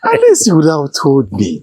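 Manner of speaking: loud, high playful reproach; booming mock rebuke of the in-law; mock scolding. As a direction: scold him loudly, but half in jest. King mock-scolding his in-law.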